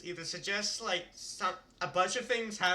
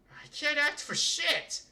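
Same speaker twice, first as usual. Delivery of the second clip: frustrated shout; 9 semitones higher, slower than usual. Acted. Teen switched into an adult body shouts at himself, frustrated. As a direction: blurt it out at yourself, exasperated. Aside, frustrated at own acting.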